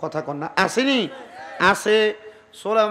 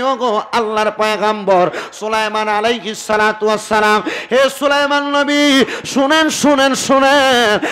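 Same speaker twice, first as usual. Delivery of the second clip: calling out; 5 dB louder than usual. Acted. Jinn hail the prophet Sulaiman, loud calling voice. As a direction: call out loudly, building on each repeat. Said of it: "আর ডাক দিয়া কয়".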